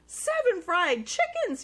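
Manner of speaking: loud, high shocked outburst; yelled. A narrator's reading. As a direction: shout it in disbelief. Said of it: "yelled his mom".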